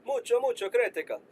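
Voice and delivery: put-on joking Spanish-accent emphasis on "mucho"; mock Spanish accent. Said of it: "let me just say it in, uh, that accent".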